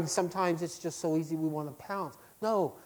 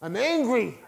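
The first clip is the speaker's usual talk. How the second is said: angry prayer; 8 semitones higher, 7 dB louder than usual. Retold or imitated. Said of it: "prayers that said".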